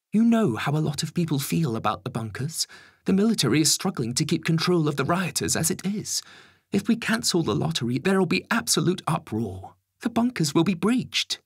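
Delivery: high, alarmed official; Home Secretary. Acted. Home Secretary warning of riots at the bunkers, high and urgent.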